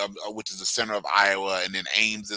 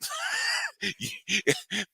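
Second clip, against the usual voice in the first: far-off shout; 7 dB quieter than usual. Acted. Burst opening the clip.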